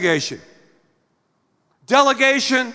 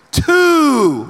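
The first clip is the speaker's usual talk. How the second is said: loud, higher shouted count; theatrical counting shout; 6 semitones higher, 5 dB louder than usual. Retold or imitated. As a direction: bark the count like a fed-up parent. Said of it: "And I get louder and louder in theatrics".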